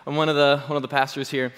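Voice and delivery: ordinary talk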